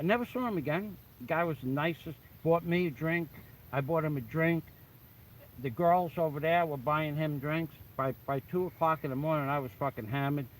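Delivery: plain reading voice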